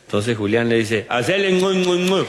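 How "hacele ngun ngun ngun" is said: high, comic ngun ngun urging the horse on; high mock horse-urging sounds; clicking to a horse. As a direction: spur the horse on with silly noises.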